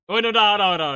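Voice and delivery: high, loud panicked no; loud protest. Real, not acted. Streamer yells at the sudden sound, loud panicked no. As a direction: shout it down in alarm.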